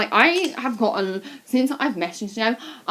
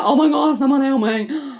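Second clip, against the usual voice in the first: high, loud mock cry for help; hammed-up distress, pitched up and loud; mock panicked plea; 5 semitones higher, 7 dB louder than usual. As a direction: imitate a helpless, dramatic wail. Retelling pregnant sister's plea for help.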